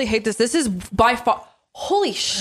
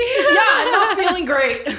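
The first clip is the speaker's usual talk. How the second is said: high, slow, laughing complaint; 9 semitones higher, slower than usual. Real, not acted. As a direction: laugh through the pain. Laughing while burning.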